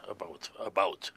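breathy hushed whisper; whispered aside. Real, not acted. Magician confiding to the audience in a whisper. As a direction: let it slip under the breath.

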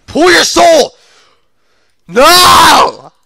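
high, loud battle yell; frantic shout. Real, not acted. Gamer calling an attack that fails, frantic shout. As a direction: yell the attack, then wail.

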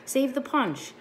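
high called-out line; mild read-aloud yell. A narrator's reading. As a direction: call it out across the yard. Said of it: "Gloria yelled to me".

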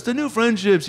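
high-pitched, lilting star impression; high mimicked celebrity voice. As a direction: greet in a high put-on voice. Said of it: "Paul McCartney's here".

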